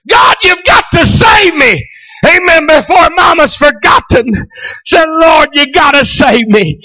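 high, loud, shouted preaching; preaching at full force. Acted. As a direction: drive the climax home to the church. Preaching climax.

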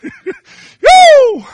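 high loud joyful woo; exultant whoop. Real, not acted. Preacher whooping to the congregation, exultant.

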